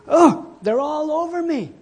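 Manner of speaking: high, loud wail; mock panic. Lice victim shrieking in acted panic, high and loud. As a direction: cry out in hammed-up horror. Acts man covered in lice.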